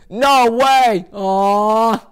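a disappointed cartoon kid groaning; Arnold from the cartoon. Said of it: "Broncos fans being Arnold saying".

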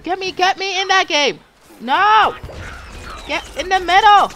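loud, high frustrated yelling; yelling at teammates in a game. Real, not acted. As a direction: shout orders at the team. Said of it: "I shouldn't yell".

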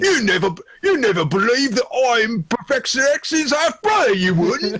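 put-on a wobbly British accent as the actor; mock British accent. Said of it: "My accent's changing with every scene".

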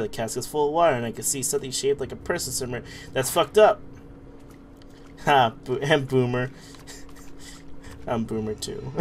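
ordinary talk

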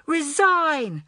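high, drawn-out chanting cry; crowd cry. A narrator's reading. Children demanding the head girl step down, crying out. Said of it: "cried the children".

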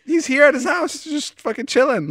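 very high amazed marvelling; amazed disbelief. Real, not acted. About Leo chilling at home, very high amazed talk. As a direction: marvel that he's just hanging out.

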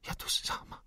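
whispered through sobs, voice gone; a broken, tearful whisper; sobbing. A narrator's reading. Girl alone, sobbing to the old soldier in a whisper. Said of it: "wyszlochała".

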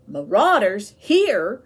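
slow, loud, startled question; sudden alarm at the news. A narrator's reading. Son hearing raiders come near, alarmed and loud. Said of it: "Pincus said with alarm".

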